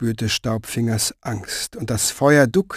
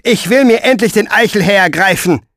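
higher, louder, grasping; he can't wait any longer; Pfeifer's greedy demand; 8 semitones higher, 5 dB louder than usual. Acted. Pfeifer demands his prize. (höher, lauter, gierig fordernd)

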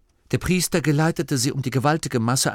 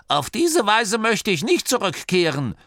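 high-pitched grumbling granny; 11 semitones higher, 3 dB louder than usual. A narrator's reading. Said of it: "grummelte Oma Morky". (nörgelnde, hohe Oma-Stimme)

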